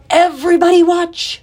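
high, loud call to everyone; cry out. A narrator's reading. Pig calls out to the watching animals before jumping, loud. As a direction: shout for the whole barn to look. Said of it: "he cried".

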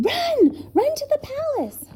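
very high, urgent shouted order; yell. A narrator's reading. Professor to the children as the bird attacks, yelling. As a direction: yell them on to safety. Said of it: "He yelled to the children".

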